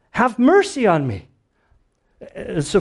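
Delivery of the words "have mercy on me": high, pleading call; crying out. Acted. Blind man begs Jesus for mercy, crying out. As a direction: cry it out, pitched well up. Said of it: "he's crying out".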